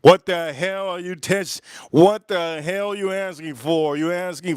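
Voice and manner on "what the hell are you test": normal talking voice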